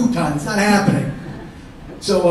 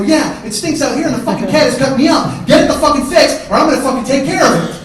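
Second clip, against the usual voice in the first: loud, higher-pitched exasperated tirade; angry rant; 6 semitones higher, 8 dB louder than usual. Acted. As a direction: build to an angry ultimatum. Acted quarrel with brother, rising anger.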